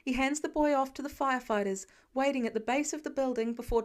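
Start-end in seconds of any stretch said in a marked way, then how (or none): none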